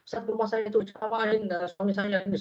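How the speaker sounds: a light hint of a woman in tears; wife begging the ustaz to lecture her husband, mock tearful; imitated crying plea. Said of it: "sambil nangis saya".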